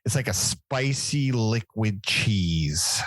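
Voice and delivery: drawn out, each word savored; slow and savoring. Real, not acted. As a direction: linger over spicy liquid cheese. Describing the dish, drawn out.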